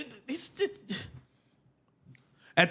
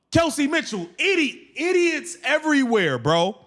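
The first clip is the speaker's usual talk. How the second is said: usual speaking voice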